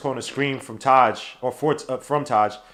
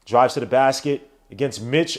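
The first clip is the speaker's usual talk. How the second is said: usual speaking voice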